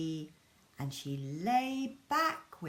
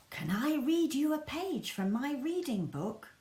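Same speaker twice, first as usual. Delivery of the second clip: put-on eager little girl's whispered ask; child whisper; 7 semitones higher than usual. Laura asking her mum to hear her read, whispered and eager. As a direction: whisper it like a keen young girl.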